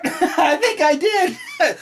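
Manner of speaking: high giggling through the words; laughing at her own snort; snorting laughs. Real, not acted. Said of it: "did I just snort?".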